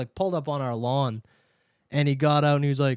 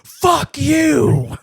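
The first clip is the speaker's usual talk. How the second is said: high, loud yell, louder on the second half; imitated mom's outburst; 12 semitones higher, 8 dB louder, much slower than usual. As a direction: bellow it like an irate mother. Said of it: "favorite quote of my mom".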